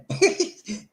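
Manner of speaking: high-pitched amused laugh; high giggle. Real, not acted. Host joking about Perry Mason, high giggle. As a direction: giggle at your own joke.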